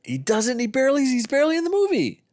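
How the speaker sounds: real high-pitched objection; exasperated protest. Real, not acted. Host disputes co-host on De Niro's screen time, exasperated protest. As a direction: argue back insistently, voice shooting up high. Co-hosts argue about De Niro's role.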